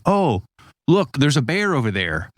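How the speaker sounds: high-pitched, mock-alarmed noticing; mildly alarmed. Amygdala scanning and spotting a bear, mildly alarmed. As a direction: act out spotting danger, pitch lifted.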